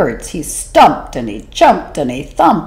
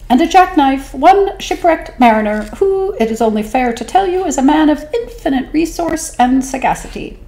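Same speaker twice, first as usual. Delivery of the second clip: a tiny sly squeak; 'stute fish voice; 9 semitones higher than usual. Acted. Small fish tempting the whale, high and sly. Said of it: "said the 'stute fish".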